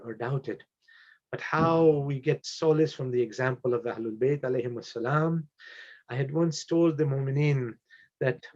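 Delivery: hushed, solemn thanks from a bereaved father; subdued tribute. Real, not acted. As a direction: speak gently through real grief. Father's tribute to his late son.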